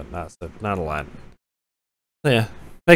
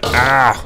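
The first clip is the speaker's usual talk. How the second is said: startled yelp; 6 dB louder than usual. Real, not acted. Startled by game danger.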